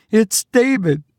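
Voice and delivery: high, tense woman's voice; anxious mother voice. Acted. Mother senses disaster, higher.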